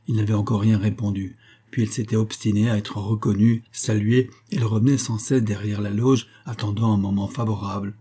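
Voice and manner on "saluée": ordinary delivery, nothing marked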